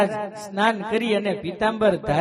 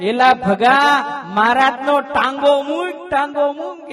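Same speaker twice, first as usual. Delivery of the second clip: loud retold rebuke, a bit higher; blunt scolding; 4 semitones higher, 6 dB louder, slower than usual. Brahmanand Swami rebukes Bhaga loudly and bluntly. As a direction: snap at him to let go of the leg.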